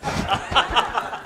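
a high, quiet giggle breaking out; high burst of laughter. Real, not acted. Speaker telling trainees the cabrón story, a high burst of laughter. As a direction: laugh at the joke you just told.

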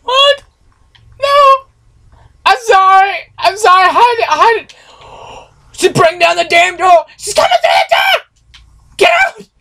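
real screaming fright; panicked screaming. Real, not acted. Screaming at in-game attacker.

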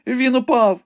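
high, loud frightened cry; sudden cry. A narrator's reading. Rebecca cries out to Ivanhoe that the knight fell, high and frightened. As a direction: blurt out the fall in fright. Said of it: "І раптом скрикнула".

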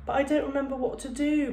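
put-on a mild childish whine; whining. A narrator's reading. Bradley frets to Carla about the party, mild whine. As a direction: complain like a worried little boy, lightly. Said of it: "Bradley whined".

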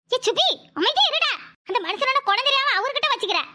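ordinary talk, nothing marked

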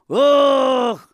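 long, loud, high-pitched wail of "ach"; one sustained shouted moan; drawn-out "ach". Acted. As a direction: stretch the "ach" out from the soul. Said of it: "Πες το, βρε, τραβηχτό μέσα από την ψυχή σου".

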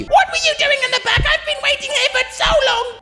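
put-on very high, fast cracked old-lady voice; shrill laughing grandma; old granny complaining. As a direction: whine like an impatient granny.

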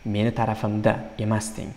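ordinary talk

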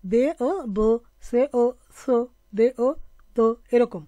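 loud, clearly spaced syllable pairs; syllable drill. Shown as a demonstration. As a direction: drill each pair of syllables firmly. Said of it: "সিরাপ দেখেছি".

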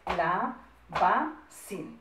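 CALABACÍN very slowly as ca, la, ba, cín; counting syllables. Shown as a demonstration. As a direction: break CALABACÍN into four spaced syllables. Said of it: "Contamos cuántas sílabas tiene".

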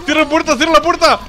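high, frantic commands building to a scream; urgent high-pitched panic; panicked shouting. Real, not acted. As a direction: yell for your teammate to flee. Fleeing a threat in game.

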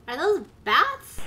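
startled shriek into laughter; alarm shout. Real, not acted. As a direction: jump scare yelp, then laugh. Startled by bats in game.

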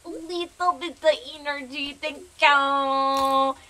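high silly voice, long loud final word; playful put-on voice. Streamer playfully begging the game for energy, silly voice. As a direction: ham it up high, then stretch the thanks out loud. Same put-on catchphrase, repeated.

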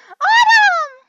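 piercingly high, full-volume scream; shrieked long call; over-excited scream. Real, not acted. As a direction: scream the lost dog's name, stretched out. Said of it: "I got too excited".

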